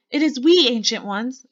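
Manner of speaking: high-pitched meek announcement, voiced loud. A narrator's reading. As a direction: pipe up high as a meek underling. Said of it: "said a meek voice".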